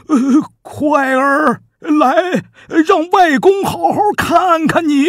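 put-on an old grandfather overcome at the sight of his grandson; tearful old king. The king, weeping, calls to his grandson, high and loud.

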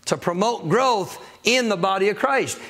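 ordinary delivery

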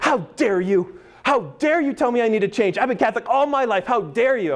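high, indignant mock outrage; upset. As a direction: act out an offended parishioner's outburst. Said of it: "she got upset".

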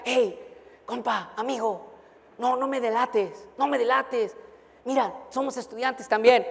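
lightly voiced student begging softly; pleading student. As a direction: beg him quietly not to report you. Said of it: "él me rogaba".

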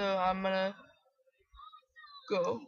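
words stretched by a yawn. Real, not acted. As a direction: say it mid-yawn. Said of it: "I'm gonna yawn".

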